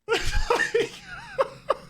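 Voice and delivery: wheezing high laugh; squealing giggles; high laughing fit. Real, not acted. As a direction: lose it again. Streamer laughing at captions.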